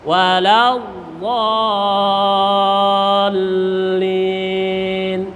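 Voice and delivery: WALADDALLIN with very long dall, pressed doubled l. Shown as a demonstration. Teacher showing the long vowel, pressed chant. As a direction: stretch WALADDALLIN hugely and lean on the l. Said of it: "Panjang ditekan".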